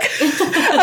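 high burst of laughter; bright laugh. Real, not acted. Mother laughing at the midwife's guess, high and bright.

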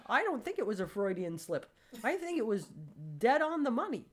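emphatic insistence. Retold or imitated. Confronting friend with his words.

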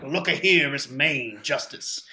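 mock New Orleans drawl, slowing at the end; hammed-up New Orleans courtroom judge; New Orleans accent. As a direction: imitate a put-on New Orleans accent, drawn out. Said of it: "I just thought of him saying".

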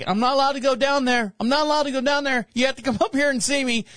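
a happy dog pleading for a visit; voicing the dog. Podcast host voices the waiting dog, playful and high. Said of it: "And he's like going".

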